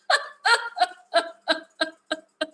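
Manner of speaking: a high, hearty laugh; amused laughter. Real, not acted. Amused by her daughter's cheeky demands, hearty high laugh. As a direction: let the laugh roll out.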